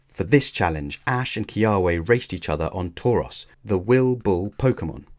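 usual speaking voice

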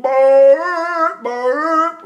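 high, slow rising pushed groan; mock modern singing. Teacher mocks everybody today, high loud braying groans. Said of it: "everybody's going".

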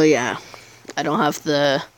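usual speaking voice

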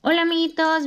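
high, loud cheery hello; bright squeaky sing-song welcome. Real, not acted. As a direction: greet the little friends brightly. Child greets viewers.

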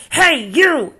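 high, loud shout at a stranger; bully's call. Acted. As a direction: pick a fight from across the room. Grouchy ladybug picks fight.

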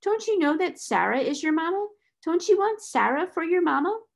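high, lilting reassurance; cheerful. A narrator's reading. Adopted mother offers herself as mama, cheerfully. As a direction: ask brightly, as to a little child. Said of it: "said Sarah cheerfully".

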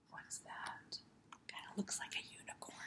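hushed suspicious whisper. Acted. The dog spots the unicorn, whispering. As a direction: whisper it like a secret.

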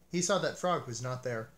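usual speaking voice

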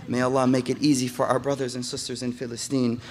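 ordinary talk, nothing marked

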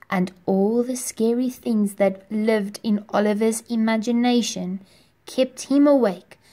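usual speaking voice

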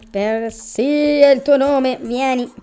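slow loud summons. Acted. Summoning genie into lamp.

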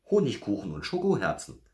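high, loud little-bear exclamation; excited call. A narrator's reading. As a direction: call out a happy answer. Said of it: "rief Berti". (hoher, begeisterter Ruf)

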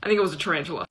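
ordinary talk, nothing marked

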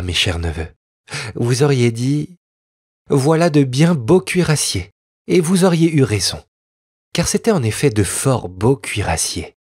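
ordinary delivery

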